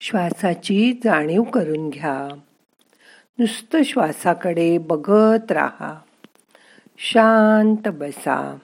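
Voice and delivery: slow, soft relaxation voice; guided meditation. Acted. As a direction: calmly guide the breathing, slowly. Leads breathing and relaxation exercise.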